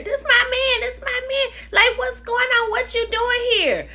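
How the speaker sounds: high-pitched mock protest of a girlfriend; imitating a woman's high, frantic objection; indignant woman. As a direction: squeal it like an outraged girlfriend. Acts out Kiana's protest in quarrel.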